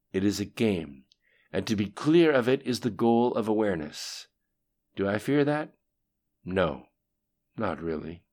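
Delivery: projected, emphatic statement of belief; composed underling. A narrator's reading. Lim Shun tells the voice he is at peace, lightly acted conviction.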